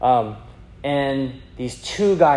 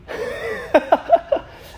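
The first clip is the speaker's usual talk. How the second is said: a high breathy burst of laughter; giggly rising laugh; 4 dB louder than usual. Real, not acted. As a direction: crack up at the memory. Laughing at the guys' answer.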